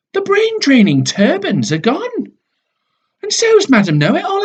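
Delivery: thrilled discovery; excited. Acted. Kids discover good news.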